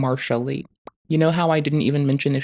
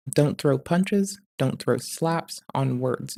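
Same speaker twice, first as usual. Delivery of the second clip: slow emphatic; 4 dB quieter, slower than usual. Real, not acted. Slowed emphatic advice.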